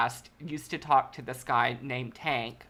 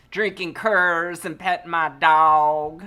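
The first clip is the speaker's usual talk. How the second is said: slow, louder mimic of her line; slow mock imitation; 2 semitones higher, 7 dB louder, slower than usual. Reactor imitates her slow drawl.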